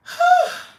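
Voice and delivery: high, loud exaggerated sigh; big theatrical sigh. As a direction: heave it out like a soap-opera star. Dramatic sigh after complaint.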